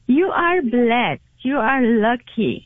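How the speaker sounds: normal talking voice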